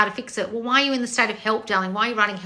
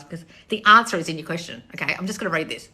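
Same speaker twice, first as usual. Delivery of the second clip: ordinary delivery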